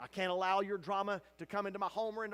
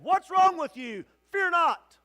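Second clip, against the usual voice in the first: high, loud rebuke; stern shout; 11 semitones higher, 15 dB louder than usual. Acted. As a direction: shake her awake with the words. Angel shaking Hagar.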